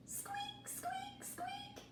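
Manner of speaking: tiny, very high, soft squeaks; mouse squeaking. Acted. Mouse arriving to help, tiny high squeaks.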